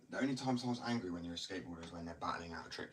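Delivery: normal talking voice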